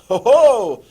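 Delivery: big, booming exclaimed ho-ho; triumphant mock laugh; loud "ho, ho!". Real, not acted. Exclaims after completing the date.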